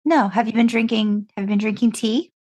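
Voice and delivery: usual speaking voice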